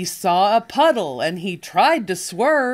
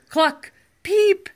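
peep; 11 semitones higher than usual. Acted. Animal sounds in a picture book.

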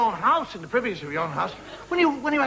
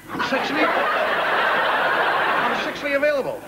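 drawn-out seductive; slower than usual. Pretend.

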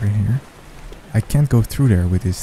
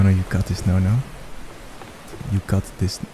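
ordinary delivery

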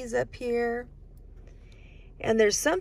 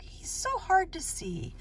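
normal talking voice

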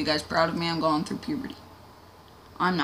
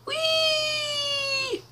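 much higher, long falling squeal; gleeful squeal; 5 dB quieter than usual. Pretend. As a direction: squeal like on a rollercoaster. Crazy driving.

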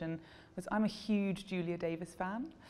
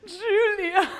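very high, much louder shriek of the name; panicked shout; 13 semitones higher, 12 dB louder than usual. Retold or imitated. The best friend spotting Julia, a panicked shriek. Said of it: "in her own panic about the story".

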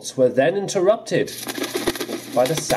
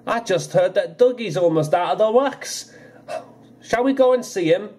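beaming; 7 semitones higher than usual. Acted. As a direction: savour the good news. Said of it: "Donald beamed".

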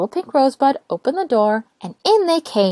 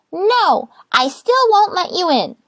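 high, slow and firm refusal; little rosebud refusing to open the door, high and stubborn; 11 semitones higher, 4 dB louder, slower than usual. Acted. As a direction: dig in your heels, high and prim.

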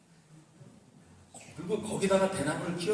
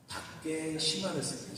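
normal talking voice

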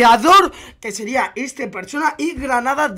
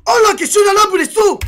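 high, loud astonished outburst; wowed by a sudden discovery; amazed; 8 semitones higher, 5 dB louder than usual. Real, not acted. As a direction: exclaim it in real disbelief. Amazed by the wires in game.

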